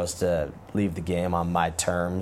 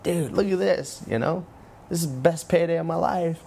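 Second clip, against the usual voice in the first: excited; 9 semitones higher than usual. Retold or imitated. Excited showing paycheck, high.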